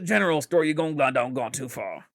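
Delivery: mock country Midwesterner, words slurred together; hammed-up rural Midwest accent. Local giving directions down the road, mock Midwest accent. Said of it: "'Cause we're in the Midwest".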